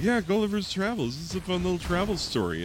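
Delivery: high, chirpy, rushed take on a fun little travel story; mock naive audience. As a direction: play the clueless reader, pitched high and fast. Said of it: "And they're like".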